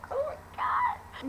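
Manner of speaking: very high, drawn-out fangirl squeal; gushing squeal. Real, not acted. Fan gushing over the movie kiss, squealing high. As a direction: squeal with giddy delight, slowly.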